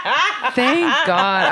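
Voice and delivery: slow, high, loud laughing; real laughter at the joke; laughing relief. Real, not acted. Reacting to funny prediction.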